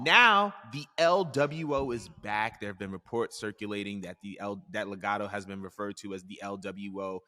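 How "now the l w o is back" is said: dramatic announcement. Acted. Host announces the stable's return.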